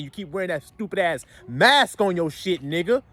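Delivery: usual speaking voice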